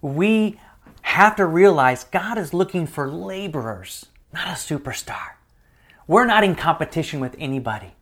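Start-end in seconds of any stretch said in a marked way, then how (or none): 2.0-5.3 whispered aside. Real, not acted. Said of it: "whispered point".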